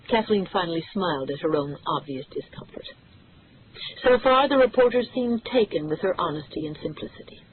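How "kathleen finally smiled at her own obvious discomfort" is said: usual speaking voice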